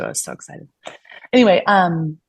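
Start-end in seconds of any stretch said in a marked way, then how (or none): 0.0-0.7 whispered aside. Real, not acted. Sarcastic aside about debt hopes.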